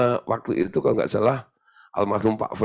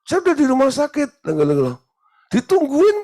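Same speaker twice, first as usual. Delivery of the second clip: high-pitched, raised-voice phone reply; acted phone exchange; 14 semitones higher, 5 dB louder than usual. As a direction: act out an exasperated caller, pitched well up. Acting out a bewildered phone exchange.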